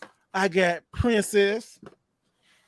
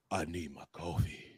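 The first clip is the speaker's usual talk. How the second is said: much lower, much softer desperate warrior voice; Kratos voice; 14 semitones lower, 15 dB quieter than usual. Retold or imitated. Waiting in line at Starbucks, in a low grim warrior voice. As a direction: sink it low like a grim god craving coffee. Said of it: "I did turn to Kratos".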